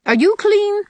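put-on high, loud demand from a strict old lady; stern demand. A narrator's reading. Strict neighbour inspecting a boy before church, severe high demand. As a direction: demand it severely as Mrs Lynde. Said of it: "she demanded severely".

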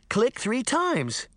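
put-on a slow, high little girl's thrill; excited cry. A narrator's reading. Daphne thrilled to know the magic words, high and eager. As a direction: gasp it out with delight. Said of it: "Daphne cried".

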